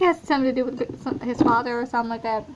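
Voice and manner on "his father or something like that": normal talking voice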